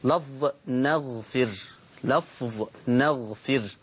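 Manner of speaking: لفظ نغفر said slowly, word by word; slow dictation. Shown as a demonstration. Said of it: "الكلمة الأولى".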